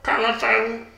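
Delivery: high-pitched put-on voice; odd musician character. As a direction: say it oddly high and stilted.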